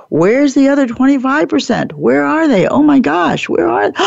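a mock frantic search climbing in pitch; mock panic. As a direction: play at panicking over the missing crew. Mock panic about missing rowers.

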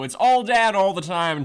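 usual speaking voice

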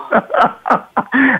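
high burst of real laughter. Real, not acted. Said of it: "I'm laughing".

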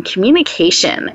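ordinary talk, nothing marked

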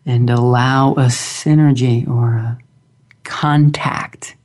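slow, calm guided meditation voice; grounding exercise. Acted. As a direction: guide them gently into stillness, long pauses.

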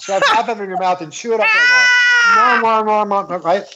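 high-pitched, insistent urging cries; excited urging. Acted. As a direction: egg them on, pitch climbing. Urging listener to imagine biting.

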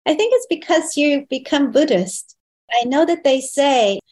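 mother wondering aloud why her daughter changed, voiced high; imitating mother. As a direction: sound like her mom puzzled but pleased.

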